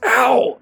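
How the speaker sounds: loud, high "ow"; acted pained yell; yelp of pain. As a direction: cry out after stubbing a foot. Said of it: "it hurt a lot kicking the cage".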